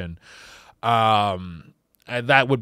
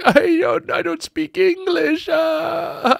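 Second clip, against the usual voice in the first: much higher, pleading foreign voice; high helpless voice; slower than usual. Retold or imitated. Contestant dodging the host's question, high and helpless. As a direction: squeak it out helplessly. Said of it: "And they're just like".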